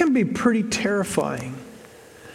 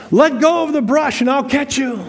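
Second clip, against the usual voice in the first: high, loud mock voice of God; voice from heaven; 8 semitones higher, 4 dB louder than usual. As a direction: call down from heaven, hammed-up. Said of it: "he hears a voice from heaven".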